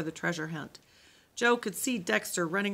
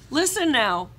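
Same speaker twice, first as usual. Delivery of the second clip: higher, louder call; calling for attention; 6 semitones higher, 10 dB louder than usual. Acted. Camp leader hushing the group, loud call. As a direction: get the kids' attention.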